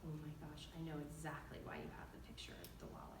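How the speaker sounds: low, soft, fading toward a whisper; hushed realization. Acted. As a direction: drop into a hushed, quick aside. Her hushed reaction to husband's call.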